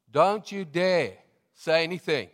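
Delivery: stern warning. Retold or imitated. Pastor retells warning to church.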